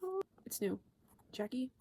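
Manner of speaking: ordinary talk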